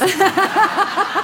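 high, slow laugh through the words; real laugh over an awkward admission. Real, not acted. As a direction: laugh it out, embarrassed. Laughs at his own confession.

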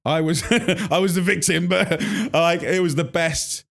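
recalling a humiliating telling-off with a laugh. Real, not acted. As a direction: laugh it out, giddy at the memory. Laughing while recalling the bollocking.

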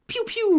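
high squeaky pew pew; playful finger gun noises. Retold or imitated. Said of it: "with his little hand guns".

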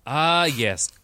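ordinary talk

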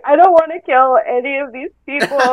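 hammed-up weepy voice; mock crying. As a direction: pretend to blubber about it. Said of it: "crying, just a ball of water".

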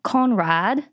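slow, lightly British Conrad; mock British accent. Shown as a demonstration. Host tries the name in a British accent. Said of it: "I just think it needs a British accent".

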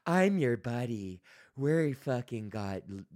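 slow, syrupy fake-buddy voice; oozing false friendliness from a grocery ad; mock friendly. Mocking a grocery boss's ads in a slow fake-friendly voice. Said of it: "being like".

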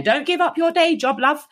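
high mocking jibe; teasing inmate. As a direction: tease her singing like a cheeky cellmate. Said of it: "one of the women going".

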